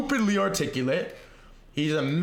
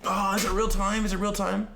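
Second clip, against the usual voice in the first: ordinary talk, nothing marked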